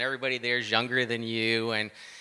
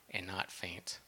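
whisper; 4 semitones lower, 11 dB quieter, slower than usual. Acted. Scripture line dropped to whisper.

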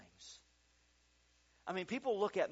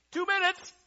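high, loud studio call; sharply raised floor-manager cry; acted countdown shout; 14 dB louder than usual. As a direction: yell it across a busy studio. Crew calling countdown in story.

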